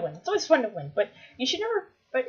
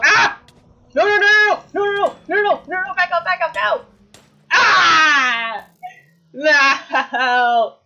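laute panische Schreie; panicked shouting; 8 semitones higher, 12 dB louder, slower than usual. Real, not acted. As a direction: schreit verzweifelt auf. Dying in game.

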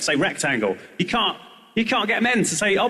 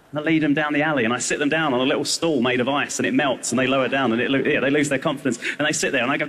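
ordinary talk

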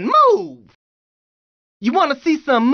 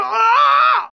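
high, drawn-out scream; acted scream of alarm, pitched up; 6 semitones higher, 4 dB louder than usual. Screams while fending someone off.

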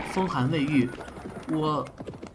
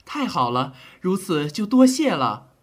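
louder, higher, eager gratitude; delighted thanks; 4 semitones higher, 6 dB louder than usual. Acted. Doctor to the general, loud delighted thanks. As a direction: burst out with relief.

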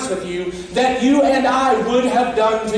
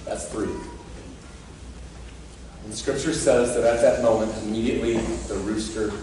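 much softer and lower, pleading and intimate; hushed preaching; 11 semitones lower, 8 dB quieter than usual. Acted. Preacher softly asking the congregation about their own failures, hushed. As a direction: lean in and let the words drop to a near whisper. Quiet reflective turn after the rooster.

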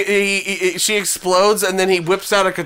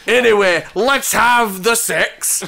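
put-on Scottish accent; 4 semitones higher, slower than usual. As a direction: proclaim it in a mock Scottish accent. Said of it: "overcome by his Scottish accent".

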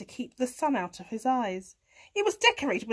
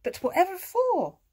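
high hen's reply; clucking hen; 7 semitones higher, 4 dB louder than usual. A narrator's reading. Hen answering cat's request, high clucking voice. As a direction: answer lightly as a storybook hen. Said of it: "she clucked".

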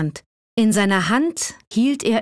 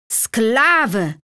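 enraged cry. A narrator's reading. Old man crying out at the defiant Assad, enraged. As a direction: spit out the insult in fury. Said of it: "rief der Alte ergrimmt".

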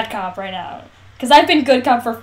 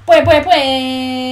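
drawn-out exclamation; 5 dB louder, much slower than usual. Real, not acted. Excited reaction to reviews.

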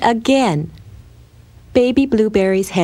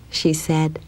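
usual speaking voice

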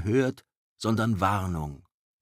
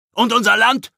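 much higher and louder yell; shouting; 12 dB louder than usual. Acted. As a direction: shout from the crowd. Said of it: "schrie jemand aus der Menge". (laut aus der Menge geschrien)

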